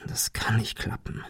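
fast geflüstertes Selbstgespräch; zu sich selbst. A narrator's reading. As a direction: sag es tonlos leise vor dich hin. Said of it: "jedoch mehr zu sich selbst". (leise vor sich hin)

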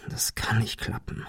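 whispered to himself. A narrator's reading. Ben doubting the phone, whispering to himself. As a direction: breathe it out under your breath. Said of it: "jedoch mehr zu sich selbst".

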